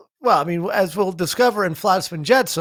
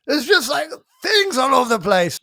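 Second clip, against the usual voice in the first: raised, overeager babble; excited babbling; 5 semitones higher than usual. Retold or imitated. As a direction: gush it like a kid. Imitating Gimli's babble.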